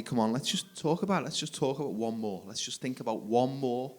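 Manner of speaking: coaxing wife. Retold or imitated.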